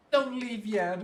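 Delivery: put-on kid voice. Kid hero chasing the pelican.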